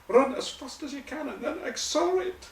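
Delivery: very high mock Scottish coach; Scottish accent. Repeating the coach's absurd order, high Scottish accent. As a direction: pitch it way up in old-Scots coaching voice. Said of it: "the old Scottish voice".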